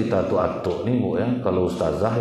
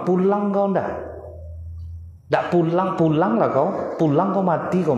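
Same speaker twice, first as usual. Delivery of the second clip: scolding mother; 10 semitones higher than usual. Retold or imitated. Mother yelling at her child to come home, mock angry scold. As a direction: nag like a fed-up mum. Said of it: "Kadang ibu nyuruhnya pulang".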